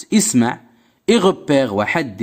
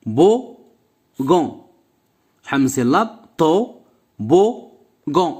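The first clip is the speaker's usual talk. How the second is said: syllable by syllable. Shown as a demonstration. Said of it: "teacher spells out syllables".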